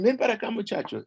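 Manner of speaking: high-pitched summons; calling over. A narrator's reading. Nahuali summoning the boy, calling out. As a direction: call the boy to you, pitch up. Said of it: "she calls".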